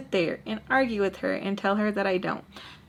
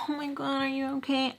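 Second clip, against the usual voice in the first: mock concern; 5 semitones higher, 4 dB quieter than usual. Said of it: "I'm not gonna sit here and be like".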